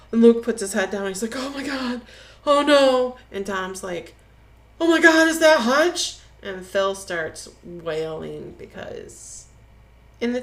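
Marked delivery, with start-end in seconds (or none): 1.4-3.2 acting out dismayed, higher-pitched shock; retold horror. Luke spots his friend in the tree, mock horrified. 4.8-6.2 acted horror, high and loud; retold alarm. Friend spots Hutch's body in the tree, acted alarm.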